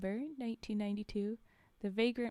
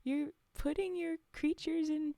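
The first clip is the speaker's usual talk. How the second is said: higher, sarcastic sing-song; mocking; 6 semitones higher than usual. Real, not acted. Reader mocking the SCP Foundation's prison logic, sarcastic. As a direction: tease the big bad Foundation. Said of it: "mocking the Foundation".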